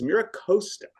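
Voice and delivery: Costa said with a long O, like "coast-a"; "Costa" rhyming with "toast-a"; corrected local pronunciation. Shown as a demonstration. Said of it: "I have to correct you".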